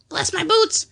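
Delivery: high, loud parrot squawk; astonished parrot. A narrator's reading. Parrot's tail pulled, squawking high in surprise. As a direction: squawk it like a startled bird. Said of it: "utter an astonished croak and call out".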